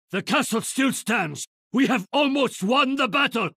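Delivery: high, ringing battle cry of victory; rally the defenders with soaring pride; a warrior's exultant call over the walls; triumphant cheer. Acted. Hero cheering mid-battle.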